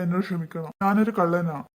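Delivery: ordinary talk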